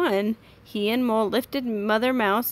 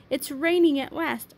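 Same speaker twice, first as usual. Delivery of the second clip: higher, delighted storybook voice; happy exclamation; 6 semitones higher than usual. A narrator's reading. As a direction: pitch it up with happy relief. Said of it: "he cried happily".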